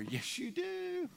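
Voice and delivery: very high, drawn-out singsong; playful high-pitched comeback, slow; teasing contradiction. Real, not acted. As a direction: tease them in a squeaky high voice, stretching the end. Playfully contradicting the congregant's objection.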